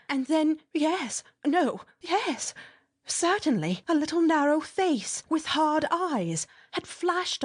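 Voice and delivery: a frightened storyteller seeing the face; breathless fright. A narrator's reading. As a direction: stammer out the terror as it appears. Mole spots the face, panic.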